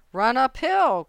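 high, loud sheep's call; crying out. A narrator's reading. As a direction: shout urgent advice. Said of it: "cried the sheep".

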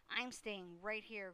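ordinary delivery

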